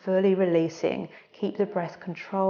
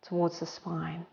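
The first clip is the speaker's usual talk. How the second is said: soft, unhurried breathing-coach voice; guided breathing cues; 3 semitones lower, 4 dB quieter than usual. Acted. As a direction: talk them gently through each breath.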